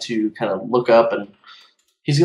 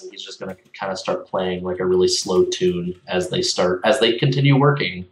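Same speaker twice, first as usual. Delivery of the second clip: ordinary delivery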